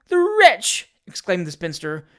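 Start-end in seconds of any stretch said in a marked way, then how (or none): 0.1-0.9 very high, loud outraged cry; energetic indignation. A narrator's reading. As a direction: shriek it as an outraged spinster. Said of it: "with energetic indignation".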